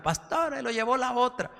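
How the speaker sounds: mock weeping complaint. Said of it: "que llegó llorando".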